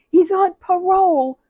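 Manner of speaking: high, loud, frantic squeal; panicked squeal. Retold or imitated. Coworker panicking to the supervisor about the caller. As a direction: squeal it out in mock terror. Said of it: "really hyperventilating".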